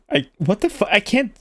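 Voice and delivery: usual speaking voice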